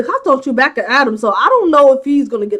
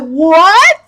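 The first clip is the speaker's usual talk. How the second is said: ordinary talk, nothing marked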